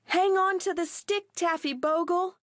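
high urgent call; cried out. Acted. Girls call to Taffy Bogle in the river, high urgent cry. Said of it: "they called".

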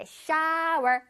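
"shower" with a long open ow, r ending; wide-mouthed shower. Shown as a demonstration. Teacher models for children, wide open ow. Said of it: "입을 크게 벌려야 돼".

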